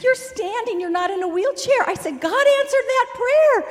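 high, loud astonished praise; overjoyed, voice leaping up; amazed joy. Retold or imitated. Telling Michael God answered, high amazed joy.

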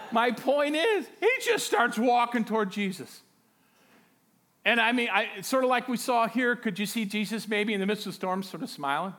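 loud, high, excited preacher delivery; full-force preaching. Acted. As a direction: preach it out with rising urgency.

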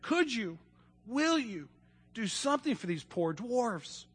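soft, high, halting plea; understated tearful storybook girl. A narrator's reading. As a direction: gently beg for help, close to tears. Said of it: "she says through her tears".

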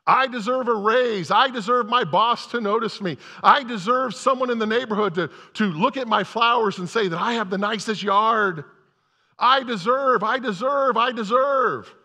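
mocking the entitled attitude; entitled whine. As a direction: hammer each I deserve. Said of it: "We carry this "I deserve something" attitude".

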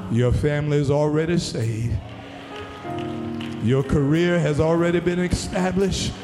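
normal talking voice

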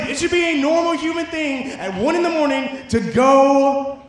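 high-pitched comic exasperation; worked-up, hammed-up outrage over lost sleep; fired up. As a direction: rant like you've hit your breaking point. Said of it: "I'm getting really fired up about this".

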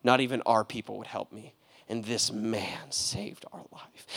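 man quietly defending Samaritans to a critic; hushed whisper. Retold or imitated. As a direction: confide it with reverence. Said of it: "he'd be like".

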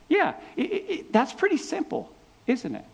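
high, mock-wondering voice; preacher teasing congregation about the simple model; it's obvious. Real, not acted. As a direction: play up how simple it is.

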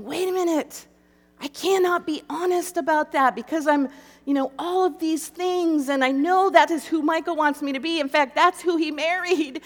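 panicked inner argument, hammed-up and tearful; mock sobbing protest. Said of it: "I'm sobbing and sobbing, and I'm thinking".

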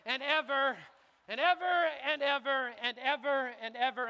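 building preaching shout. Real, not acted. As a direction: hammer and ever again and again, rising to a shout. Preacher repeating and ever, stubbornly.

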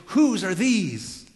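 slow, high, loud demand; emphatic examination. Acted. Tamar demanding whose these are, slow and emphatic. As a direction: drag out each word as a demand. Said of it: "this, this emphatic examination".

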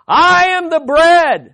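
loud, high, slow proclamation; proclaiming call. Retold or imitated. Preacher voicing Jesus calling out to the festival crowds, loud and slow. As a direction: call it out over a huge crowd.

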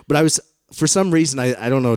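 ordinary talk

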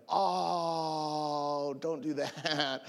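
drawn-out whining protest; whiny plea. Acted. Robbed man begs robbers not to dump him, whiny. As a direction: plead like a whining child, slowly.

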